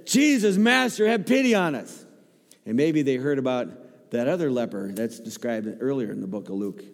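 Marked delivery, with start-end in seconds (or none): 0.1-2.1 high, loud plea for mercy; pleading cry. Acted. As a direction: cry out to be heard from afar. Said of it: "And they said".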